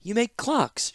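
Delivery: high, sugary princess voice; sweetly. Acted. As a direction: say it sweet as honey, a little condescending. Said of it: "she said sweetly".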